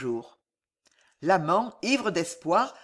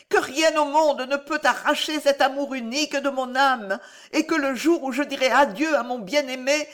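higher, louder, fervent, slowing on the last line; Alice's fierce confession; 8 semitones higher, 5 dB louder than usual. A narrator's reading. Alice defying the monk, desperate and fierce. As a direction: pour out a desperate love.

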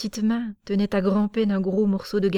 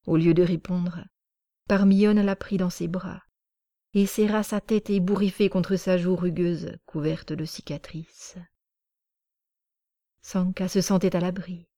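ordinary delivery, nothing marked

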